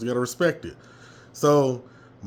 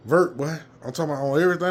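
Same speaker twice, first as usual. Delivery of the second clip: ordinary talk, nothing marked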